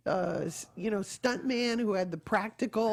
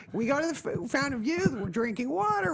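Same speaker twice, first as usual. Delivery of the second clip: usual speaking voice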